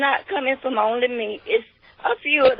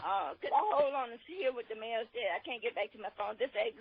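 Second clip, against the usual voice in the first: phone line; 3 semitones lower, 5 dB quieter than usual. Real, not acted. Caller dialed in by phone.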